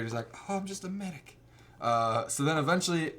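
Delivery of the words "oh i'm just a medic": high, soft Southern medic; mock Southern accent, pitched up; Southern boy. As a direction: play a meek Southern boy. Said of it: "he's, like, a Southern boy".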